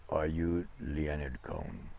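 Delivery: hint of a Russian cabbie's accent, softly. Cab driver asks about Leonard, in a lightly imitated Russian accent. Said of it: "thick Russian accent".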